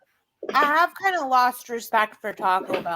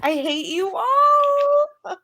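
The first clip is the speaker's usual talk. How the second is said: mock whine; 13 semitones higher, 3 dB louder, much slower than usual. Jealous mock complaint to friends.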